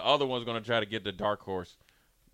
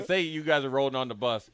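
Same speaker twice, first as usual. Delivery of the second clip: normal talking voice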